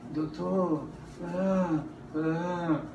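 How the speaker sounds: a hint of a tired patient sighing "Doutor"; soft, weary sighs imitating the patient. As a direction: lightly mimic a worn-out patient. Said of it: "sabe aquela voz cansada".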